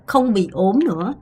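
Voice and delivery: normal talking voice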